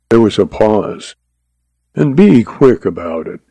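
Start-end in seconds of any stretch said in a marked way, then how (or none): none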